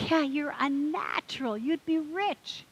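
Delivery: a hint of a salesman's pitch; eager bartender.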